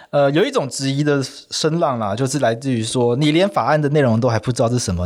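ordinary talk, nothing marked

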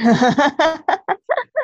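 a sudden high burst of real laughter that climbs; rising burst of laughter. Real, not acted. Host laughing at his own drunk reply, a high rising laugh. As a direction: break into a big rising laugh.

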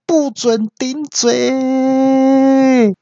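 very high, slow drawn-out mock shout; hammed-up no-talking-back yell. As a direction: stretch out the scolding. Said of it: "我就大叫说".

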